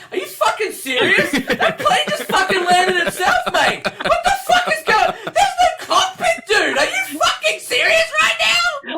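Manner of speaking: high, loud, laughing disbelief at the plane; incredulous shouting. Acted. Airfield worker yelling at Barton in disbelief, laughing shout. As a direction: yell it like he can't believe his eyes. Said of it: "And he's like".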